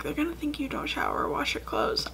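imitating dad in a whisper; whispered dad quote. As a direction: whisper dad's worry like a secret. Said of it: "my dad was like".

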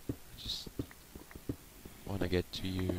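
whispered under the breath; soft whisper. Real, not acted. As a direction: hush it, sneaking closer. Sneaking up on a zombie.

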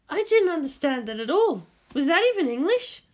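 put-on high, puzzled young woman's voice; baffled Misato. A narrator's reading. Misato confused by Shinji's English, high and baffled. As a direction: sound lost in a high voice.

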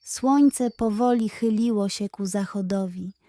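plain reading voice

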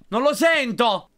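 high loud slow complaint; frustrated shout. Real, not acted. Gamer unable to find the ringing phone, frustrated shout. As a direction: bark it out in frustration. Can't hear the phone ring.

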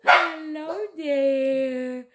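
very slow, drawn-out coo; cooing greeting. Real, not acted. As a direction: stretch out a hello to the puppies. Coos a greeting to puppies.

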